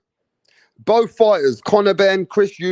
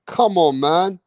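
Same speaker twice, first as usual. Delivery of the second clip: ordinary talk, nothing marked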